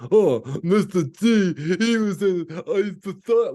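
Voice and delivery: loud, high hammed-up troll voice from the fake reviews; mocking trolls. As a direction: ham up a dim troll stumbling over words.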